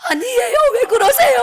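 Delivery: imitating a pastor who was just crying; high loud mock tearful surprise.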